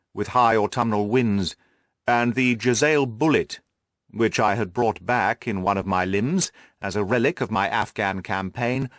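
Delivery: usual speaking voice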